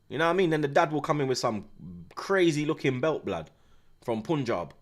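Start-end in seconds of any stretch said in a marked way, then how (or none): none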